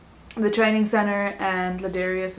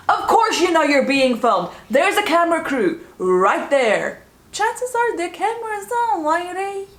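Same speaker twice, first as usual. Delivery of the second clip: louder, much higher sarcastic jab; exasperated mockery; 8 semitones higher, 5 dB louder than usual. Real, not acted. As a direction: scoff at her out loud, rising to a squeal. Mocking the administrator on camera.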